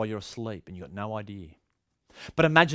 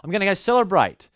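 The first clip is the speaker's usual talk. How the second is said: the master announcing, higher and louder; lightly voiced master, raised and bright in volume; master off to a wedding; 8 semitones higher, 8 dB louder than usual. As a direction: call it out cheerfully as the master heading off. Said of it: "say to his servants".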